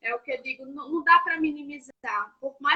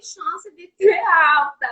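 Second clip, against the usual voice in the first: crying; 3 semitones higher, 7 dB louder, much slower than usual. Real, not acted. Breaking down recalling hospital.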